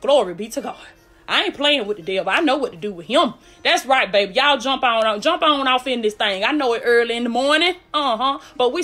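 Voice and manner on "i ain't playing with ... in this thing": usual speaking voice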